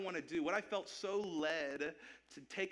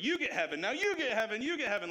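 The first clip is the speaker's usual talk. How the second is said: building excited announcer shout, higher and louder; Oprah-style giveaway; 7 semitones higher, 6 dB louder than usual. Retold or imitated. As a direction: hype it up like a TV giveaway host. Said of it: "You pull an Oprah".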